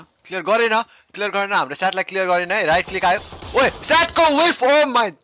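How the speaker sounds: building to a high, loud yell of disbelief; frantic esports caster at full volume; excited commentator shouting. Real, not acted. Caster reacts to a dramatic miss.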